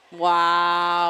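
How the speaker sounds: loud, drawn-out wow; amazed reaction. Real, not acted. Commentator as the match is won, loudly amazed. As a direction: let out a big, awed exclamation.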